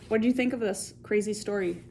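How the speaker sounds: ordinary delivery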